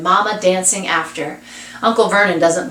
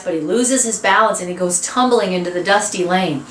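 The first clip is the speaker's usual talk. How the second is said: usual speaking voice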